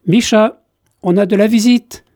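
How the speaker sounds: put-on little girl hailing a guest's arrival; calling out. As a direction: call Micha from the next room, bright and loud. Girl calls out to Micha.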